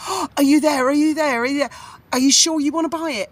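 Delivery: a seller grabbing the phone, frantic; desperate seller. Retold or imitated. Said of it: "she was desperate to sell".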